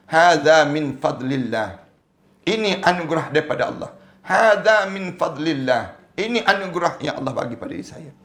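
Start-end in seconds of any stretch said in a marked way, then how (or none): 0.1-1.7 slow, deliberate Arabic phrase; slow recitation. Shown as a demonstration. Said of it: "kita sebut". 4.2-6.0 slow, deliberate Arabic phrase; slow recitation. Shown as a demonstration. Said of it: "kita sebut".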